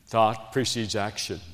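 a soft first take of "Thought precedes action."; quiet statement. Shown as a demonstration. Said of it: "You hear me?".

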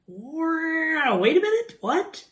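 very high, stretched mock double take; screeching-halt disbelief. Listener hits a puzzling verse, mock shock. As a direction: squeal to a baffled stop like brakes. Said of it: "brakes in your mind just being applied".

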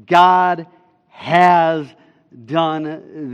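"God", "has", "done" each set apart; emphatic. Shown as a demonstration. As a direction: land heavily on "God has", stretch "done". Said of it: "for the emphasis is".

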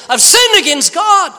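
high, loud cry; loud confession. Retold or imitated. David confesses to Nathan, crying out loud. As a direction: cry out the guilt. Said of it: "And David said".

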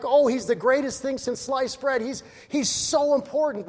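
loud, high sarcastic sneer; resentful son's loud sarcastic sneer; mocking jealousy. Older son mocking father's favour.

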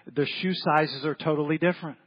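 ordinary delivery

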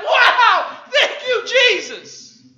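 loud, high jubilant thanks; joyful praise. Pretend. Believer praising Jesus for the blessing, jubilant. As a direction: burst out in thanks.

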